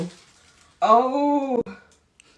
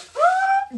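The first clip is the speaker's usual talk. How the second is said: sharp, very high gasp of delight; 4 dB louder than usual. Real, not acted. Opening a birthday gift, delighted gasp.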